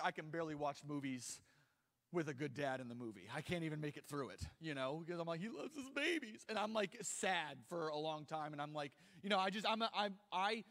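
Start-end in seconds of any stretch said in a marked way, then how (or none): 5.4-6.5 higher, teary mock sob; a touch of tearful gushing; choked-up imitation. Imitating himself crying at movies.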